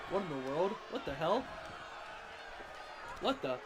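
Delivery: ordinary delivery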